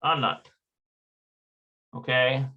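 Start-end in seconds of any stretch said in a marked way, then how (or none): 0.0-0.5 "Anat" opening on a clear ah; native pronunciation. Shown as a demonstration. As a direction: say "Anat" as AH-nat, not Annette. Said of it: "they pronounce it like".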